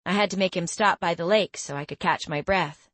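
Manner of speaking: usual speaking voice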